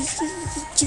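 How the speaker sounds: ordinary delivery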